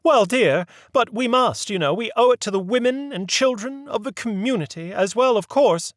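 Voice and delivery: put-on a proper old lady leading the inquiry; prim lady voice. A narrator's reading. Mary Mabel insists on duty.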